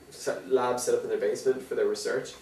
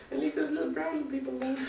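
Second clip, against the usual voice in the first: mock protester whine; 14 semitones higher, 8 dB quieter than usual. Mocking protesters' plea.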